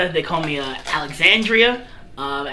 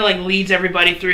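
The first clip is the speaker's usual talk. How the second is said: ordinary delivery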